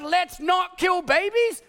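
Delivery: high, slow, pointed plea; indignant plea. Retold or imitated. Pastor mocking being called Taliban, slow indignant plea. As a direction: spell it out slowly, high and indignant.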